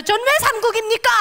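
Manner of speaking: high, loud indignant demand; protesting. Retold or imitated. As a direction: challenge God, feeling cheated. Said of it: "하나님께 또 따졌어요".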